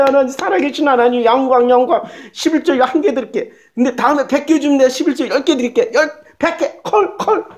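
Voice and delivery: high-pitched, overexcited bargaining with God; mock greedy prayer. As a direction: gush thanks like a greedy worshipper. Mocking acted greedy, bargaining prayer. (욕심에 들뜬 기도 흉내)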